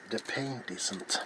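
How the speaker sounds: ordinary talk, nothing marked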